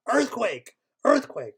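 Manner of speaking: high, loud panicked shout of earthquake; screaming. Acted. Skier screaming the alarm to everyone, loud panicked shout. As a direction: yell the warning at full force. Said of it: "somebody screams".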